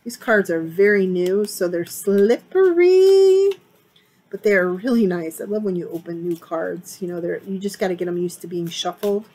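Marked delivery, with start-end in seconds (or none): 1.8-3.5 playful squeal. Real, not acted. Playful remark on slippery cards.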